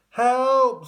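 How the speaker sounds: high, loud cry for help; scream. A narrator's reading. Tom flying off the banister, screaming for help. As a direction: scream for rescue. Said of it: "screamed Tom".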